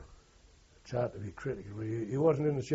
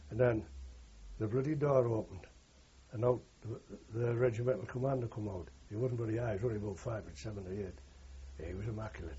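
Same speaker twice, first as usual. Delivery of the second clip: ordinary talk, nothing marked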